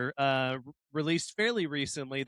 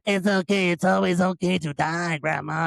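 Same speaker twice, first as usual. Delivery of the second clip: mock Middle Eastern accent; 3 dB louder than usual. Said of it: "You sound like a fucking Mexican pirate".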